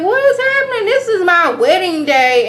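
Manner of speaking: bride's parents crying out at the brawl at the wedding; shocked outcry. Retold or imitated. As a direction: shriek it like your wedding just fell apart. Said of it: "come in like".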